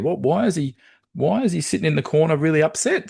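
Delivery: high, baffled coach; puzzled wondering. Acted. Coach wondering about his upset player, high puzzled voice. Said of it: "kind of wonders".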